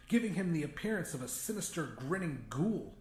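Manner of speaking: ordinary delivery, nothing marked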